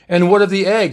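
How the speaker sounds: probing, inquisitive query. A narrator's reading. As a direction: ask with keen interest, lifting the pitch. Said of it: "Spock asked inquisitively".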